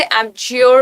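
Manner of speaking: एब्ज्योर with a sounded final r; common misreading. Shown as a demonstration. Teacher showing how many people misread it, एब्ज्योर with final r. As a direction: end एब्ज्योर on "-yor", r heard. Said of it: "ध्यान देना है pronunciation पे".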